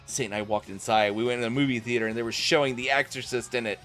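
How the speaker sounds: ordinary talk, nothing marked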